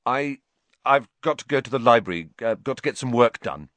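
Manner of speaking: halting start, then hurried excuse; stumble, then get the excuse out; hesitant excuse. A narrator's reading. Harry escaping an eager admirer, flustered excuse.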